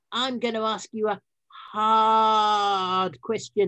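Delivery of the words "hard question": dramatic slow. Acted. Teasing a harder question.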